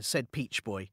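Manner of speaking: ordinary delivery, nothing marked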